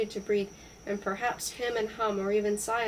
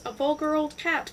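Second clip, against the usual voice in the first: higher-pitched indignant cry; exclaimed retort, raised pitch; 6 semitones higher, 4 dB louder than usual. A narrator's reading. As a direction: cry it out in mild outrage. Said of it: "cried Lawrence".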